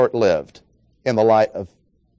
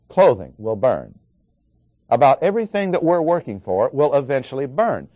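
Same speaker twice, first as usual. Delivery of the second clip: normal talking voice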